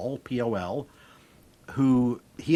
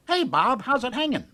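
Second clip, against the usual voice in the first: squawking parrot; 12 semitones higher, 4 dB louder than usual. Acted. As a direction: squawk it out like a parrot in a new home. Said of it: "Parrot goes".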